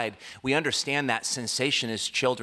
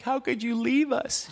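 put-on very high-pitched childish wail; hammed-up squeaky upset; mock crying. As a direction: imitate a tearful child accusing mom. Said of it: "I'm just crying".

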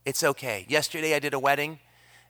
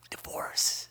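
urgent, voiceless whisper of a correction; groom's whisper; 7 dB quieter than usual. Retold or imitated. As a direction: hiss the word to the pastor under your breath. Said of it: "the groom goes".

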